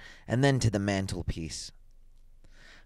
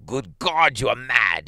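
loud, horrified exclamation; shocked old husband, voice raised; aghast cry; 3 semitones higher, 6 dB louder, slower than usual. A narrator's reading. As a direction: cry out in appalled disbelief. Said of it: "he cried aghast".

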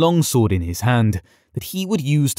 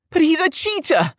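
put-on high, tearful boyish outburst; crying out in rage. A narrator's reading. Boy lord protesting the win, high and tearful. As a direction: wail it like a spoiled child. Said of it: "Yared cried".

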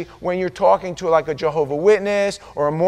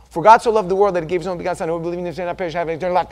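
rattled-off recitation; much faster than usual. Shown as a demonstration. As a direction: reel off the verse by heart, fast. Rattles off the verse from memory.

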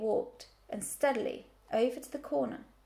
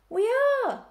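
high, loud drawn-out cry of agreement; chorusing; 12 semitones higher, 9 dB louder than usual. A narrator's reading. Flock agrees with the old ewe, chorusing loudly. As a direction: call it out like a whole crowd. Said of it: "chorused the flock".

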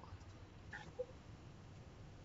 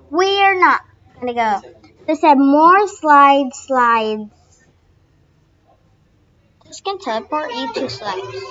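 usual speaking voice

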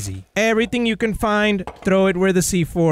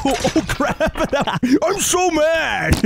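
laughing; 5 semitones higher, much slower than usual. Real, not acted.

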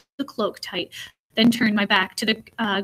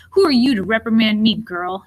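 louder snarl that drops away on the last word; 5 dB louder than usual. Acted. Jailer snarls at the girl scolding him. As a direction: snarl it back at her. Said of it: "he snarled".

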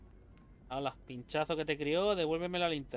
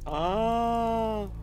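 startled cry; 5 semitones higher, 4 dB louder than usual. Real, not acted. Startled by something in game.

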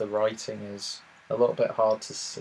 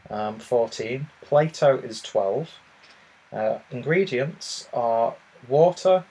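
usual speaking voice

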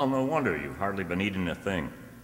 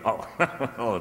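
laughing; 5 semitones higher than usual. Acted. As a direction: laugh it off lightly. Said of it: "Why are you laughing?".

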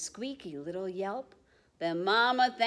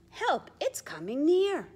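alarmed; 8 semitones higher, 4 dB louder than usual. Acted. Alarmed cry in picture book.

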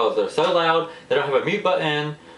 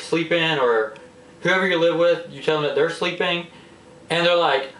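usual speaking voice

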